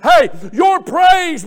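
ordinary talk

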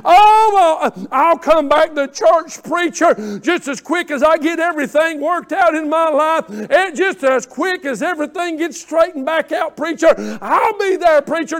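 play-acted eager excuses; mock excuse-making. Mimics an absent church member's promises.

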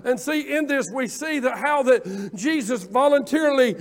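loud, high, full-force pulpit preaching; fiery preaching. Acted. Pastor preaching to his church, full-force preaching. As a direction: preach it hard to the congregation.

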